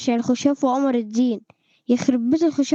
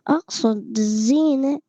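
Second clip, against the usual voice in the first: slow, stretched "أقصد الزينة"; careful retake; slower than usual. Shown as a demonstration. Retaking a line he said oddly, slow careful "أقصد الزينة". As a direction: draw out both words "أقصد الزينة" deliberately. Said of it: "مش عارف ليه أقصد بقولها كده".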